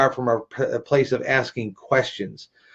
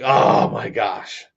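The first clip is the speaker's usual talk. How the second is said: mock exasperation; 3 semitones lower, much slower than usual. Imagined audience groan.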